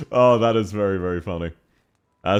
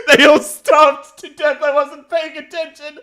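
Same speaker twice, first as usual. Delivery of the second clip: hammed-up despair, pitched way up; mock wailing; 11 semitones higher, 4 dB louder than usual. Mock lament over starved game civilization.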